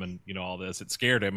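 ordinary talk

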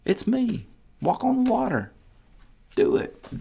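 Jesus gently urging Peter onto the water, softly and high; gentle Jesus. Acted. Jesus calling Peter out of the boat, soft and coaxing. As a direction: coax him softly, almost under the breath. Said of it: "And Jesus says".

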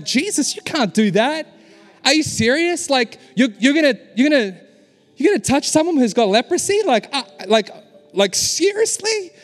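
high-pitched incredulous outburst; shocked disbelief. Retold or imitated. Onlooker reacting to Jesus touching a leper, incredulous. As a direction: play the scandalised bystander.

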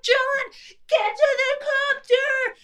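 mock urgent crew. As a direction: beg John to hurry, hammed up. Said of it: "they're like".

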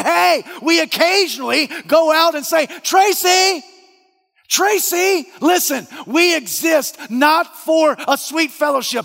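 very loud, high shouting climax; preaching shout. Real, not acted. Preacher shouting to the congregation, full force. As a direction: shout it to the back of the church.

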